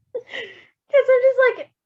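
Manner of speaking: very high, slow squeal; squealing disbelief. Real, not acted. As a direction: stretch it out in giggly disbelief. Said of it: "x0.4".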